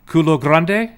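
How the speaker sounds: Culo grande said loud with Spanish sounds; Spanish pronunciation. Shown as a demonstration. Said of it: "it means big a".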